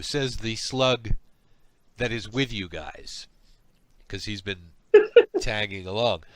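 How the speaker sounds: ordinary talk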